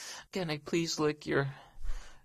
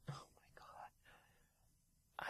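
shocked whisper; 22 dB quieter, much slower than usual. Acted. Shocked aside.

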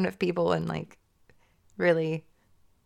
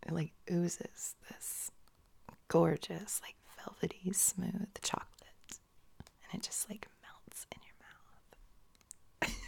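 sexy whisper; 11 dB quieter than usual. Pretend. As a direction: draw out oozes in a seductive whisper. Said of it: "but in a sexy voice".

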